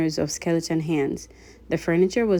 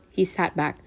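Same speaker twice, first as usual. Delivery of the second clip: ordinary delivery, nothing marked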